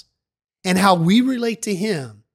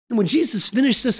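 ordinary delivery, nothing marked

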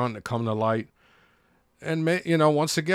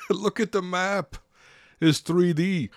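higher, giddy mock excitement with a laugh; mock hype; 5 semitones higher than usual. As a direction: ham up a hyped fanboy. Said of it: "they're like".

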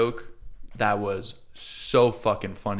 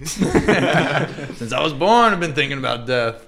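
laughing through a teasing echo of his words; laughing mockery; 3 semitones higher, 4 dB louder, slower than usual. Real, not acted. Teasing his friend, laughing, echoing his line. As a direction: repeat it back while cracking up.

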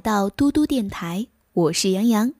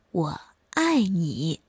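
slow, soft and lower, tenderly; tender declaration; 5 semitones lower, 4 dB quieter, slower than usual. A narrator's reading. Young tree tells its mother it loves her, slow and tender. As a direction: let each word land slowly and softly, with love.

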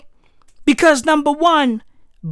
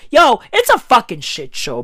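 ordinary talk

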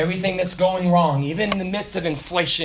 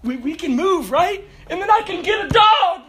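much higher, rising to a happy shout; father voices his excited daughter asking for a dog, high and loud; excited child; 12 semitones higher, 6 dB louder than usual. Retold or imitated. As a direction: squeal it like a kid begging for a dog. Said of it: "my daughter's excited".

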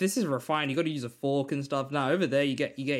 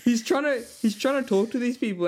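ordinary talk

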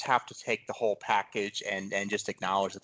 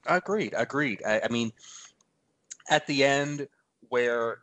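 ordinary talk, nothing marked

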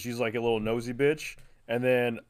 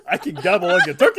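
higher, louder, quick hotline parody; mock sex hotline; 5 semitones higher, 5 dB louder, faster than usual. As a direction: laughingly parody a hotline come-on. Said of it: "like, sex hotline thing".

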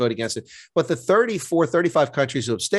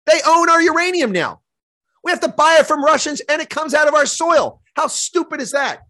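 much higher and louder, indignant; furious rant at selling the uranium; indignant outrage; 12 semitones higher, 4 dB louder than usual. Real, not acted. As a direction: let the outrage climb high and loud. Outrage over uranium sales.

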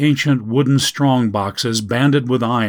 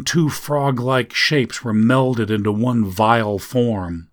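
ordinary delivery

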